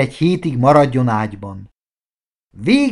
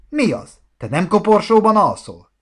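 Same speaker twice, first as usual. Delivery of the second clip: mocking; 8 semitones higher, 3 dB louder than usual. A narrator's reading. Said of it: "kérdezte gúnyosan".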